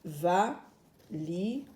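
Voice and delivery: valise drawn out slowly, syllables apart. Shown as a demonstration. Teacher sounding out a word for pupils, slowly.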